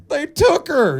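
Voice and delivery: high, urgent father voice; panicked father. Retold or imitated. Belle's dad bursting into the tavern, high and urgent. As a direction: blurt it like a frantic dad. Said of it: "He's like".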